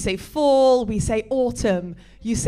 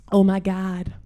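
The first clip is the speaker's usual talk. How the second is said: lower-pitched mock Valley girl; Valley girl accent; 4 semitones lower, 3 dB quieter than usual. Shown as a demonstration. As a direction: demonstrate a badly faked Valley girl accent. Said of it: "my Valley girl is the best I can do".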